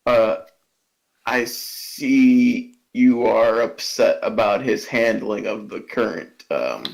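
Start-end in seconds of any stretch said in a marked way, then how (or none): 1.2-6.9 lower, measured polite servant; a hint of a soothing, deep servant; calm low servant. Bastion calmly addressing the angry ghost, low and measured. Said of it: "he just kinda says".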